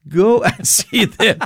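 chuckling through the words, slow; a laughing elderly lady, gently sending him off. Retold or imitated. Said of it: "And she laughed and she said".

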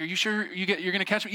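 high, lightly worried question; mock wary kid. Brother checking with sisters before falling, mock wary. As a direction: ask like a doubtful little brother.

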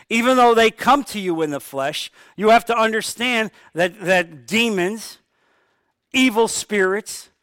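ordinary talk, nothing marked

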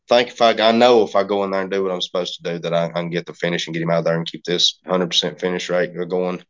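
ordinary talk, nothing marked

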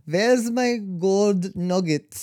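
put-on high, slow German accent, "Where's my gold nuggets?"; the Dutchman's ghost demanding nuggets in a German accent. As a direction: drag it out like a grumpy German miner ghost. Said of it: "no, no, no, the German".

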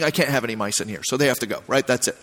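low soft foreman explaining the rule; calm matter-of-fact foreman. Retold or imitated. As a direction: explain it flatly to the upset coworker. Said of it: "he's like".

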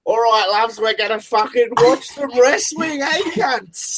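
high-pitched, rowdy Australian bogan announcer; over-the-top bogan. Acted. Girl announcer greeting wrestling fans, over-the-top Australian bogan. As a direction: hype it up in a screechy, full-on bogan accent. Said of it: "hundred and ten percent bogan".

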